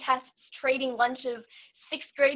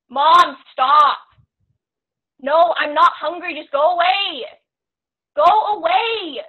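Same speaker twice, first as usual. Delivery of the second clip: put-on loud, raised-pitch teen outburst at mom; angry yelling; 4 semitones higher, 13 dB louder, slower than usual. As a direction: yell to drive mom away, stretching the last words. Acted teen shouting at her mom.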